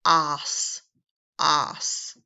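teacher modeling a nonsense syllable; sounding out os. Shown as a demonstration. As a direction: say "os" as "ahs", clearly. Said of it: "The next sound is".

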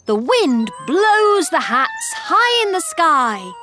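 usual speaking voice

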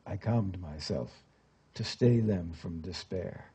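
subdued narration, softening at phrase ends; poetry recitation. A narrator's reading. Imprisoned father tells how he calmed himself, subdued recitation. Recites a translated poem aloud.

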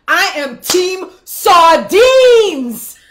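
slow, high, loud reveal; dramatic reveal. Real, not acted. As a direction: announce it like a big reveal. Big reveal.

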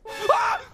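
sharp high jump-scare shriek; startled scream. Real, not acted.

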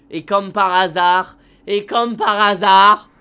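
loud, slow, knowing sarcasm; sarcastic drawl. Real, not acted. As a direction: drag it out, mocking the obvious setup. Sarcastic about hitchhiker coincidence.

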